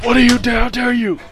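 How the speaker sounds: high, hammed-up offended squeal; mock anime outrage. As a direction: play a huffy anime hero.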